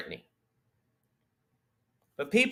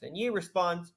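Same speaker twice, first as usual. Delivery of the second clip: ordinary talk, nothing marked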